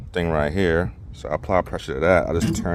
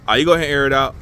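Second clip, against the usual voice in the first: calling out; 7 semitones higher, 7 dB louder, much faster than usual. Real, not acted. Calling out to his helper.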